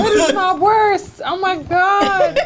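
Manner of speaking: laughing. Real, not acted. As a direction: giggle it out slowly. Laughs at being asked.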